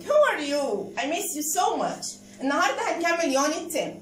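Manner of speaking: usual speaking voice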